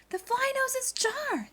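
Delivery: high astonished voice; amazed judges. Acted. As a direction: marvel at it.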